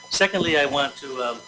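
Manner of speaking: ordinary talk, nothing marked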